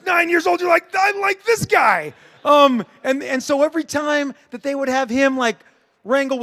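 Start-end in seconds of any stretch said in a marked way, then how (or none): 0.1-2.1 put-on higher and louder, a thrilled kid gushing; excited kid. Recalling his nine-year-old self loving Wolverine, excited shout.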